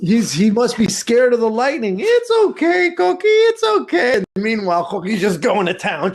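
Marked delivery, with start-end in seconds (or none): 0.0-4.2 put-on high soothing kid voice. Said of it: "and I'm thinking".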